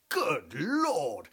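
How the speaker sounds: lightly voiced Hastings exclaiming; a hint of a dim English gent. Comic aside mocking Captain Hastings, a mild mock exclamation. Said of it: "is to say".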